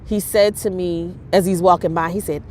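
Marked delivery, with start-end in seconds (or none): none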